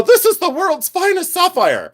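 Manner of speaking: very high, put-on seller voice; mock sales pitch. Reseller hyping a sapphire to buyers, high mock sales pitch. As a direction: gush it in a silly high squeal.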